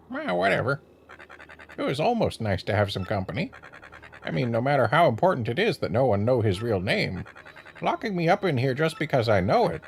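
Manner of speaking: a hint of a cranky talking bird; parrot voice. Voices parrot character dialogue.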